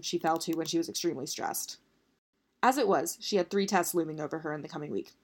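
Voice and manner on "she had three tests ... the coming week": ordinary delivery, nothing marked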